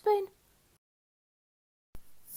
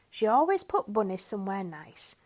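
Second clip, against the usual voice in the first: plain reading voice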